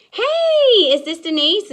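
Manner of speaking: sunny sales call opener; bubbly phone greeting. Shown as a demonstration. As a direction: greet her like her best news of the day. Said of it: "This is what it's gonna be".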